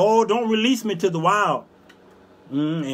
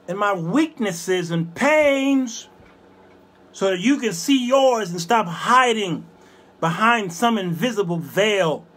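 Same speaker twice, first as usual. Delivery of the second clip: high, emphatic preaching with long pauses; 7 semitones higher, 4 dB louder than usual. Real, not acted. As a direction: declaim each phrase to the congregation. Preaches on being whipped by God.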